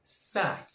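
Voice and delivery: sat with an open short a, sat; sat, vowel a stressed; model word. Shown as a demonstration. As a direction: say "sat" clearly for pupils to copy. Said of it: "You can hear this sound in the word".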